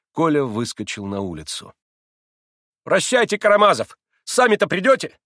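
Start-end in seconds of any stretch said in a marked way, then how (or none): 2.8-5.2 loud, higher, curt; sharp angry shout. A narrator's reading. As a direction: snap at him gruffly. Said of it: "резко и сердито крикнул он". (резко и сердито)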